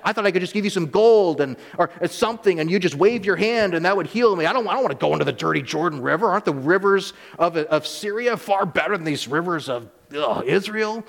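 Naaman scoffing at the prophet's order to bathe in the Jordan, indignant; indignant rant. Acted. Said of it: "he's actually upset".

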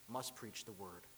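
ordinary talk

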